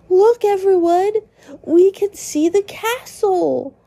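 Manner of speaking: gentle high Ralsei voice. Acted. Narrator voicing Ralsei as he points out the castle, high. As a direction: lift into Ralsei's bright young voice. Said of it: "Ralsei interrupts us from walking and says".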